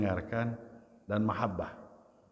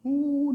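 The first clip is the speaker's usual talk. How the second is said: high-pitched mock sob; imitated crying wail; 14 semitones higher, 4 dB louder than usual. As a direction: let out a drawn mock wail. (tiruan tangisan bernada tinggi)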